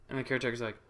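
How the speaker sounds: ordinary delivery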